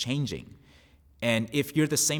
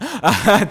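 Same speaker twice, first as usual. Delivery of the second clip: laugh; 12 semitones higher, 14 dB louder than usual. Real, not acted. Laughs at own joke.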